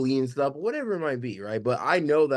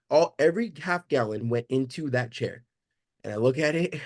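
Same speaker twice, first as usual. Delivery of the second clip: ordinary delivery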